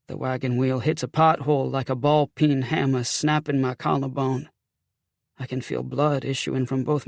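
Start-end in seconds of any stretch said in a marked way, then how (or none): none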